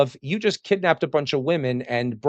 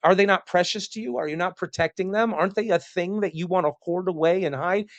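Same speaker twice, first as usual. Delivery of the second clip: higher, incredulous pleading voice; baffled poet; 6 semitones higher than usual. Retold or imitated. Poet calling out to Spartans, higher and incredulous. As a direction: play a poet begging them to hide their women. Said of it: "the poets are just saying, like".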